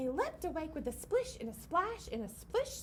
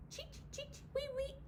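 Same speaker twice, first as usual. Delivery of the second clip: tiny high cheeping; high soft bird cheeps; 12 semitones higher, 7 dB quieter than usual. Acted. Reading to kids, soft high bird cheeps.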